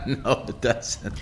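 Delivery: real laugh running through the words; laughing agreement. Real, not acted.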